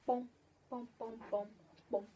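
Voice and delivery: normal talking voice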